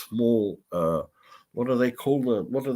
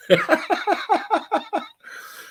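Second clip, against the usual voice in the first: high, bursting laugh of real amusement; helpless high cackle; big laugh. Real, not acted. Pearl's pepper spray refills.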